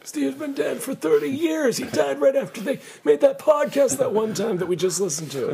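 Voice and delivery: hammed-up high old voice; old-timer. Old self talking about dead friend.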